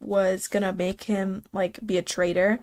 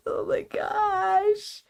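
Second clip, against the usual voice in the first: squealing; 12 semitones higher, much slower than usual. Real, not acted. Fangirling over ship.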